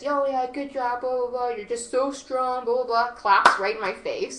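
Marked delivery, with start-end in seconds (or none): 0.0-3.1 mock sarcastic retort, a little higher; mocking put-on voice of an ex; mocking imitation. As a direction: imitate him, dismissively rattling it off. Mockingly retells his sarcastic retort.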